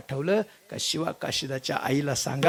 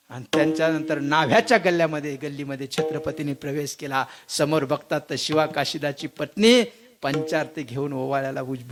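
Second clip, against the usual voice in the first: normal talking voice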